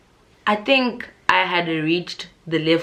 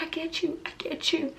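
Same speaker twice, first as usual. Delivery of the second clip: much higher, softer weepy voice; tearful sympathy; 11 semitones higher, 8 dB quieter than usual. Retold or imitated. Tipsy her, crying along with a venting stranger, high and weepy. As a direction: say it through tears, choked up. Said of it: "I start crying".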